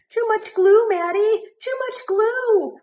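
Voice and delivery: high, loud squawk of a panicked teacher; teacher squawking about too much glue. A narrator's reading. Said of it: "my teacher squawks".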